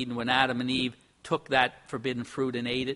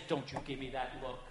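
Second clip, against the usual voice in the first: stern mom; 2 semitones lower, 11 dB quieter than usual. Retold or imitated. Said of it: "you ever hear mom say".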